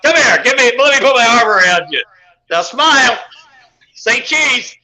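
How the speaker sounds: pushy photo-op hype. Pretend. Imagined convention photo huckster.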